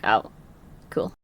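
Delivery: low, soft deadpan acceptance; small, muted acknowledgement; underwhelmed reaction. Retold or imitated. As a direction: drop it down quietly, as if resigned. Retold underwhelmed reaction to ten shots.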